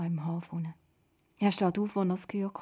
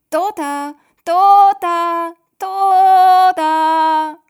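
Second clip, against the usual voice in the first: loud high calls, ever louder; father searching the shore, calling loud; calling out again; 11 semitones higher, 19 dB louder, much slower than usual. A narrator's reading. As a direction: yell the name out over the water. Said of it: "ruft er nochmal".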